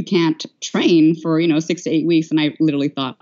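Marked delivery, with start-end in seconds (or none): none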